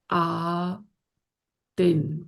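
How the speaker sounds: آتن stretched extremely slowly; very slow ending. Shown as a demonstration. Teacher showing the plural ending to students, very slow. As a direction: hold آتن long and deliberate. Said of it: "بتاتی ہوں میں دونوں".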